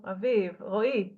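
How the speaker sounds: ordinary delivery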